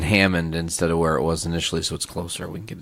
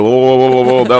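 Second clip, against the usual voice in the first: louder, higher mocking blah blah; imitating the cook; 4 semitones higher, 9 dB louder than usual. Friend repeating the cook's voice, louder and mocking. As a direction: parrot his excuse mockingly. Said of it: "exactly how he sounded".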